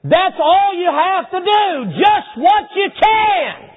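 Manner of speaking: high, loud, slow preaching shout; slow emphatic shout. Acted. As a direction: drive each word home. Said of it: "preacher emphasis".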